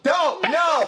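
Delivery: high-pitched yelp of protest; re-enacted yell. Viewer recounting an outburst at a shocking film scene, re-enacted yell. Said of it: "I audibly yelled out loud".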